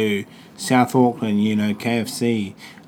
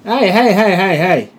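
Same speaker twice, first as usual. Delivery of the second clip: high, loud Aussie "hey"; Australian accent; 8 semitones higher, 7 dB louder than usual. Shown as a demonstration.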